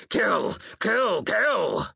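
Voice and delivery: high, repeated battle chant; shouted war cry. A narrator's reading. Warrior urging the crew on over and over, shouted. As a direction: yell each word as its own blow. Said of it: "I shouted".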